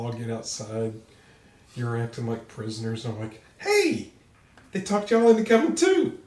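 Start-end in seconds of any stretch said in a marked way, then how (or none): 3.6-6.2 loud high angry yell; shouting. Acted. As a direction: shout across the yard. Shouting at fellow prisoners.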